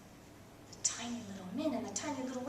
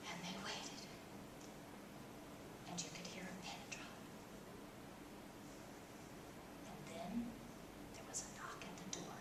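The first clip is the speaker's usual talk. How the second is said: hushed storyteller whisper; suspenseful whisper; 6 semitones lower, 16 dB quieter than usual. A narrator's reading. Narrator whispering the tense wait to listeners.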